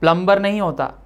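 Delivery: usual speaking voice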